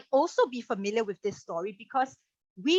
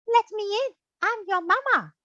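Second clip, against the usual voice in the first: much higher, sweet put-on mother voice; a wolf pretending to be sweet Mama; wolf faking Mama; 11 semitones higher, 3 dB louder than usual. The wolf fakes Mama's sweet voice at the door. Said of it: "get his voice all smooth and soft like Mama".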